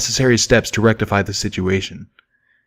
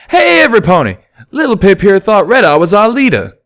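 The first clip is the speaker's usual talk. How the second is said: much higher and louder, mocking call out; calling to the bar; 11 semitones higher, 7 dB louder than usual. A narrator's reading. Stallion announces Pip's mistake to the tavern, loud and mocking. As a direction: shout it to the whole room, teasing. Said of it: "The whole tavern joined in on the laughter".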